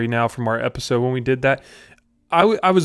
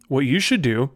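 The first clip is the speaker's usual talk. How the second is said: normal talking voice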